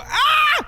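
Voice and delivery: sudden high yelp of panic; panicked yelp. Real, not acted. Panics under time pressure.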